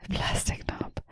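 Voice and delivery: breathy whispered echo. Real, not acted. Echoing her friend's joke, in a whisper. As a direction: whisper it back for a laugh.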